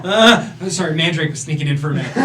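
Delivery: apologising through real laughter; laughing. Real, not acted. Player apologizes while laughing.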